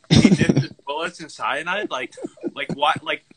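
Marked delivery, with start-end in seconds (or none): 0.1-1.9 higher, louder, rising in disbelief; he WHAT? mocking disbelief; incredulous repeat. Real, not acted. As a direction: repeat it like it's absurd. Mocking the quoted line.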